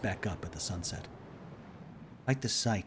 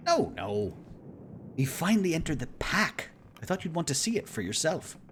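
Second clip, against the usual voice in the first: a hint of a jovial explorer; lightly voiced, cheerful celebrity; charming navigator voice; 8 semitones higher than usual. Kurt voice again.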